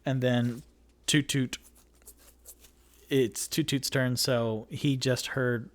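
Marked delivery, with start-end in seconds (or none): none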